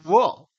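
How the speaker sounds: 우어 repeated as the Chinese sound, not 무; teacher insists China says 우어, not 무; Chinese reading. Shown as a demonstration. 우어를 중국식으로 또박또박. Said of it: "무라고 안 해요".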